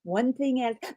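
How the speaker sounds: soothing hush. Retold or imitated. Friend calming a panicking student, soothing hush. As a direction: gently talk her down. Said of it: "I was going".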